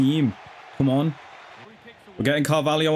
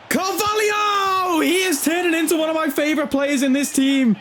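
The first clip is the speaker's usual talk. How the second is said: ecstatic goal shout; 10 semitones higher than usual. Real, not acted. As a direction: belt out the scorer's name and stay elated. Shouting as Carvalho scores.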